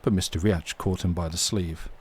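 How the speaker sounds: plain reading voice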